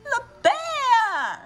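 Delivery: high dramatic bear voice; a hint of crying bear; big bear. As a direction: call out the bear with a cry. Parent reads bear story, bear voice.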